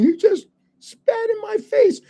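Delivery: mock him with a high, whining protest; whiny accusing guy. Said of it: "he starts going".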